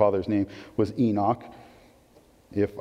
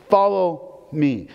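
drawn out, each word weighted; slow, deliberate invitation; slow emphatic call; 4 semitones higher, 3 dB louder, much slower than usual. Acted. Preacher voicing Jesus calling disciples, slow and deliberate.

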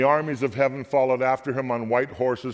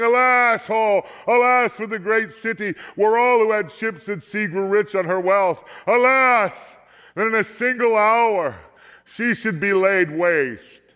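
wailing lament; 7 semitones higher, 3 dB louder than usual. A narrator's reading. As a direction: wail out "alas" high and loud, slowing at the end. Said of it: "weeping and wailing and saying".

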